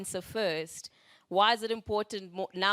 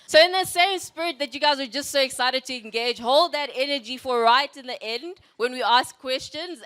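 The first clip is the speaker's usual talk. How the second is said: louder, energetic push to the crowd; hyped-up host; 4 semitones higher, 9 dB louder than usual. Real, not acted. Host firing up the crowd for questions, loud and energetic. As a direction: pump the audience up for questions.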